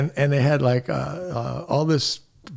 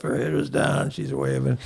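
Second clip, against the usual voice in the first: usual speaking voice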